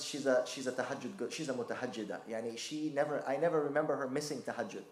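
usual speaking voice

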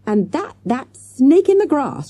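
high, loud, slow shriek of outrage; betrayed teacher shrieking at the doctor. A narrator's reading. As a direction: screech the insult across the room. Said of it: "she shrieked".

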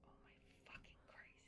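hushed frightened curse; terrified whisper. Real, not acted. Hiding from monster.

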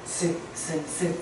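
스 said softly, between an s and a z; in-between consonant. Shown as a demonstration. Teacher explains 반치음 to the class, demonstrating its in-between sound. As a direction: quietly demonstrate 스 as a sound midway to 즈. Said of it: "스와 즈의 중간 발음이에요".

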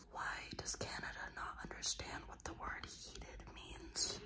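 unvoiced whisper, hushed and complaining. Real, not acted. To viewers from a quiet sauna, a hushed grumbling whisper. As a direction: whisper so nobody in the sauna hears.

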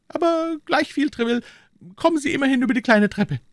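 very high-pitched king's voice; insistent king. Acted. King sending Treville by the small stairs, high voice. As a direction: wave it aside in a high, lively voice.

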